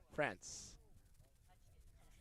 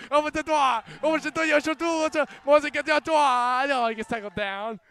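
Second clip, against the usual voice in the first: excited rugby commentary, higher and louder; live play-by-play; 4 semitones higher, 8 dB louder than usual. Acted. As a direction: call every pass with rising excitement.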